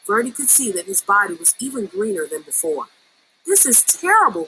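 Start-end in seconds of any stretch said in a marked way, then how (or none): 3.5-4.5 high distressed cry; cried out. A narrator's reading. Verdi sees greener skin, distressed cry. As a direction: wail at turning greener. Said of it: "cried Verdi".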